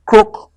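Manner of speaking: frog croak. A narrator's reading. Frog noise.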